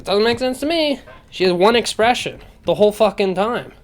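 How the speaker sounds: high-pitched, incredulous complaint; exasperated rant. Real, not acted. Critic on an actress with one expression, exasperated rant. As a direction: let the bafflement push the pitch up.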